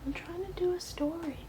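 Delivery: whispered, high and soft; whispering. Real, not acted. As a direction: hush it to the cat. Whispers same line again.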